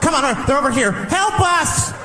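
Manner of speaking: high frantic mock shouting for help; panicked bystander. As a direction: beg the officer, high and panicky. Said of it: "Somebody might run up on me".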